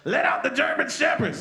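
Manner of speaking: high, loud yell. Retold or imitated. A cop yells to release the dogs, loud high yell. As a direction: yell the order across the street. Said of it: "Then somebody yells".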